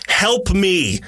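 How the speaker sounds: high, urgent cry for help; yelling for help. A narrator's reading. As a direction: call out for help in a panic. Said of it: "The woman yells".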